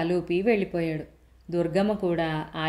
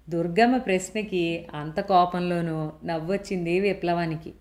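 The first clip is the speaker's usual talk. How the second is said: ordinary delivery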